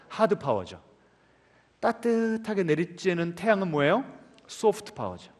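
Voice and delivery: ordinary talk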